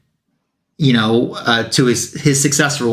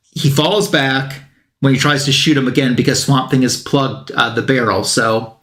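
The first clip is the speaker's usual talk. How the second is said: ordinary talk, nothing marked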